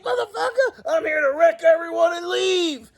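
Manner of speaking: high, hammed-up shouting promo; laughing mock promo yell; mock wrestler promo. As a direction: yell it like a hyped-up heel. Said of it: "Roman Reigns comes out and is like".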